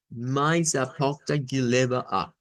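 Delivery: slow Brazilian Portuguese reading. Shown as a demonstration. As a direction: read it slowly with a Brazilian accent. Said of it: "Esa es la parte que llevamos". (lectura lenta con acento brasileño)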